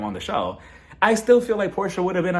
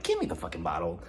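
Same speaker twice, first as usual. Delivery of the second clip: low, rough pretend order, much lower and softer; pretend brusque demand; 4 semitones lower, 3 dB quieter than usual. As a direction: growl it low like grabbing the bottle. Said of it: "I would've just told the man".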